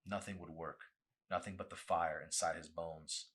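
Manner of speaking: usual speaking voice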